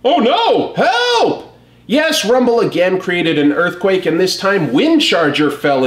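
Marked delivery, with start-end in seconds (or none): 0.1-1.6 high, hammed-up robot yelp for help; comic-book robot panicking, much higher; mock panicked cry for help. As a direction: squeal it out like a cartoon robot falling. Robot falls into an earthquake.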